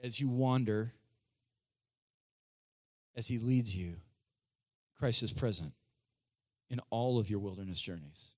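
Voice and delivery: low, phrase by phrase with long pauses; deep-pitched, deliberate comfort, softening at the end; solemn reassurance. Real, not acted. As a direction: reassure them in a low, measured voice. Brings it home one more time.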